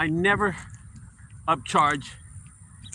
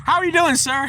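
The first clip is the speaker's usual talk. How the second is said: cocky greeting; 9 semitones higher than usual. Retold or imitated. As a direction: greet him with smug swagger. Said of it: "like I have an attitude".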